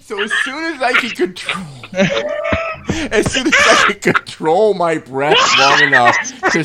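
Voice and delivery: high voice breaking into laughter; breathless laughing. Real, not acted. Recalling laughing too hard to breathe, laughing. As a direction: fight real giggles to get the words out.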